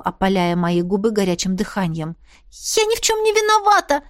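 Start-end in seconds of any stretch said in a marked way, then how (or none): 2.8-4.0 high, panicked squeak; frightened squeak. A narrator's reading. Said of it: "судорожно пискнула". (испуганный писк)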